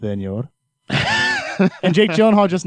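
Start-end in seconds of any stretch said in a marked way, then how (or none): 0.0-0.5 low sultry Spanish "Senor"; Spanish accent. Retold or imitated. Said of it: "he's like". 0.9-1.8 sudden high laughing shriek. Real, not acted. Laughing at own story.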